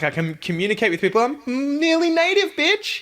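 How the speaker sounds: squeaky overconfident show-off boast; cocky mock boast.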